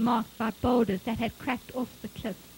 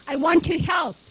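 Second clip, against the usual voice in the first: raised, loud, determined plea; firm insistence; 6 semitones higher, 9 dB louder than usual. A narrator's reading. Mother insisting on helping find her son, firm. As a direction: insist on being allowed to stay.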